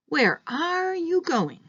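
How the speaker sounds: "are" stretched slow and high, falling later; stress on ARE. Shown as a demonstration. Teacher shows where intonation can land, stress on ARE. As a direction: lean long and high on "are" in the question. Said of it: "you can decide which word you want the intonation falling".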